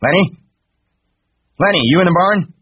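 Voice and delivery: high, loud searching call. A narrator's reading. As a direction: call for him across a barn. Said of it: "a voice called".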